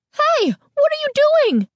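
very high, shrill demand; shrill and angry. A narrator's reading. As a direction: demand it like an outraged mother. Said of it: "her voice shrill and angry".

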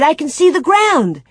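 put-on high, excited child's announcement; excited cry. A narrator's reading. Girl telling her mother the snow has gone, excited cry. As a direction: burst out with the news, pitched high. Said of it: "she cried".

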